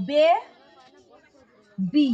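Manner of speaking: বে and বি said apart, long pause between; split into syllables. Shown as a demonstration. Teacher showing pupils two syllables, split with pauses. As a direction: break it into বে ... বি, one beat each. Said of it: "দুটো শব্দ".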